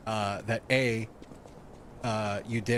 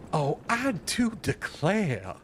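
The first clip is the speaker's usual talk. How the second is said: slow, drawn-out Southern belle drawl; hammed-up Southern belle; 5 semitones higher, slower than usual. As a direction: play up a Southern belle accent. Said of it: "Do a Southern belle".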